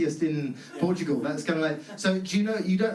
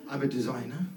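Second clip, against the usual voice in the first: lower, quieter, with a touch of proud mock; 6 semitones lower, 5 dB quieter than usual. Comic mocking a guest who says he's a designer, with pride. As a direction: mimic his quiet pride in the job. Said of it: "there was a certain amount of pride".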